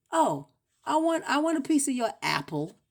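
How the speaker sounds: high, loud eager request; ordinary asker. Acted. Someone asking for a piece, high and loud. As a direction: pipe up high, wanting some. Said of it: "They say".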